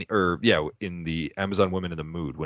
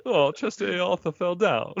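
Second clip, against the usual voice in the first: much higher, put-on silly voice; goofy dumb voice; 13 semitones higher than usual. Podcaster quoting dumb crew member, high goofy voice. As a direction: squeak it out like a clueless oaf.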